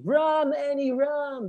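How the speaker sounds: high-pitched demonstrative cry. Shown as a demonstration. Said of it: "Cry also means to scream loudly".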